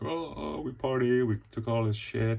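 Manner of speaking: high, loud party whooping; partying excitement. Retold or imitated. Townsfolk boasting about their party, excitedly. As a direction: whoop it up like drunk revelers. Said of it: "They're like".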